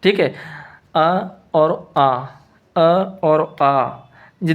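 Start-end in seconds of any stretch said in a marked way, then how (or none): 0.9-2.1 slow vowel demo. Shown as a demonstration. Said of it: "देखो अ और आ". 2.8-3.9 अ और आ stretched out very slowly, each vowel held; very slow vowel demo. Shown as a demonstration. Teacher slowly sounding out अ और आ for students, very slow. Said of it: "देखो अ और आ".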